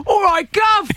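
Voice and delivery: high, loud cockney voice mocking London; London accent. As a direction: put on a cheeky cockney "all right, Gov". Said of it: "London accent".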